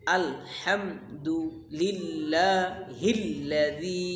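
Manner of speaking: very slow আলহামদুলিল্লাহিল্লাযী, each syllable held; teacher's recitation demo. Shown as a demonstration. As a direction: drag out the whole phrase. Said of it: "আবার করিয়ে করে দেখেন".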